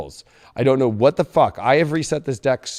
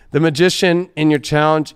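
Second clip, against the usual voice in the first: ordinary talk, nothing marked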